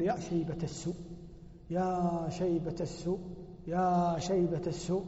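soft, slow self-reproach. Retold or imitated. Old man rebukes himself at night, soft and slow. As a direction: quietly lament your own grey beard. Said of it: "ثم يقول".

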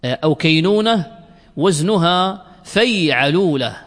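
normal talking voice

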